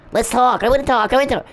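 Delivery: high, loud whiny mock of a pestering man; a shrill, exaggerated imitation of the stalker, pleading to talk. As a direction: squeal it like a creep begging for attention.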